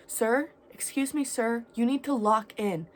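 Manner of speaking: put-on stern girl telling a stranger to get it together; firm command. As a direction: snap him out of it with a firm call. Girl firmly ordering crackhead.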